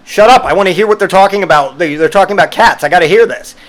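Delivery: loud, high mock yell; imagined snapping at a chatty customer; exasperated outburst. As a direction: blurt it at the customer. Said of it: "I caught myself three times from just being like".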